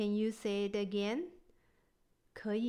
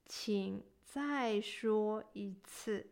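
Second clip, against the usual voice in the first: ordinary delivery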